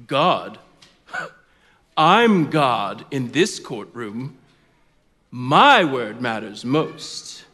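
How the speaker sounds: low, slow scoffing judge; arrogant judge. Acted. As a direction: pronounce it slowly, lord of the courtroom.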